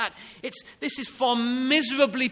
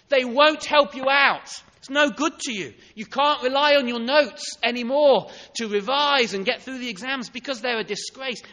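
higher, louder, insistent preacher's voice; fervent preaching; 4 semitones higher, 5 dB louder than usual. Acted. Preacher scolds imagined lazy student in loud emphasis. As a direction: press it home with a rising, driving voice. Preacher drives home illustration.

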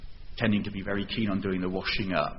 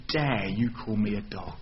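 higher, indignant rant at Jesus; furiously offended; 5 semitones higher than usual. Acted. Offended mother protesting to Jesus, indignant and rising. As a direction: bristle with wounded pride, then build to outrage. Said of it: "She's going to be furiously offended".

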